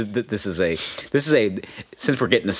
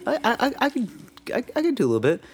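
put-on high, hesitant stammering kid; nervous stammer; 13 semitones higher, 4 dB quieter, faster than usual. Shy teen stammering to his friend's dad. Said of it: "Just like".

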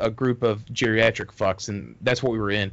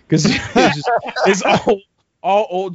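higher, louder, talking through laughter; laughing; 7 semitones higher, 5 dB louder than usual. Real, not acted. Storyteller explaining his punchline to friends, laughing. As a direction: crack up while trying to explain.

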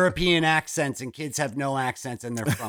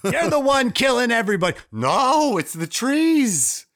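Wahlberg Boston impression; 12 semitones higher, 4 dB louder than usual. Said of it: "Mixed with his Boston accent".

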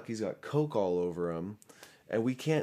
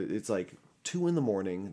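ordinary delivery

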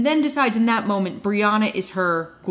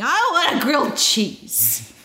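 retold scream; 5 semitones higher, slower than usual. Podcaster imitating a wasted girl in the back seat, mock scream. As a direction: draw out a half-hearted mock scream. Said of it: "passes out in the back seat screaming".

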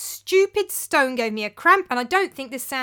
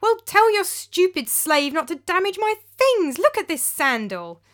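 much higher and louder, indignant; angry complaint; 9 semitones higher, 6 dB louder than usual. Acted. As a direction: rage over the sandal. Philip angry over shoe.

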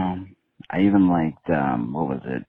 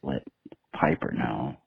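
ordinary talk

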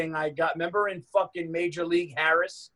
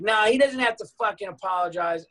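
raised, mildly indignant imitation of a wife; mock indignant wife; 6 semitones higher, 4 dB louder than usual. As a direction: butt in like an offended wife. Retold wife butting in angrily.

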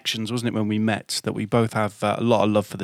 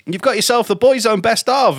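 higher, mock-cheery jock voice; imitating a DJ; 9 semitones higher, 3 dB louder than usual. Mock DJ hands a caller the prize. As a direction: ham up the cheery radio host. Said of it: "I can't do the Irish accent".

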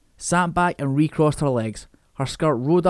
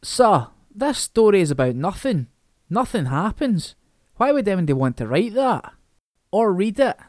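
mild shout; 5 semitones higher than usual. Acted. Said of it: "Gordon Wright shouted".

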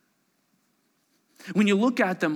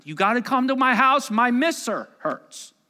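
put-on high, loud grandma calling the kids; a hint of a grandma summoning; grandma pleading; 9 semitones higher, 7 dB louder than usual. Grandma calling her grandchildren to visit, high and loud. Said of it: "a grandma who would say".

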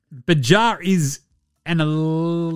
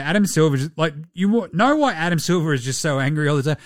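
ordinary talk

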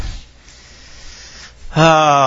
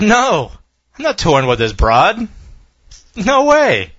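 mock flat refusal; 7 semitones higher than usual. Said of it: "Iglesias straight up said".

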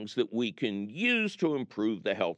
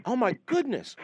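alarmed; 10 semitones higher than usual. Real, not acted. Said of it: "no, I'm serious".

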